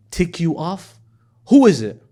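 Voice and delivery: sudden loud demand; protective demand. Acted. Ali demands to know who upset the Prophet, loudly. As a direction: bark it out, ready to defend.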